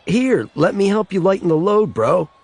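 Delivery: a lightly voiced high jokey buddy; playful friend. A narrator's reading. Best friend Kenny teases Raymond about the beers, playful. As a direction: tease him with a wink.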